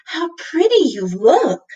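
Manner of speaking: a lightly voiced cat full of admiration; cat complimenting Lindy, mild; admiring. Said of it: "said the splinter cat admiringly".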